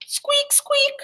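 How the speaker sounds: very high squeaky rat. A narrator's reading. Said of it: "said the rat".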